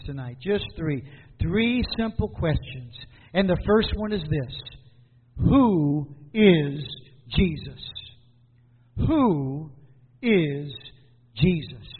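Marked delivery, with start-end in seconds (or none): 5.4-11.9 "Who is Jesus?" low, with pauses between words; deliberate questioning. Real, not acted. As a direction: set "Who", "is" and "Jesus" apart, pitched low. Poses his first question, twice.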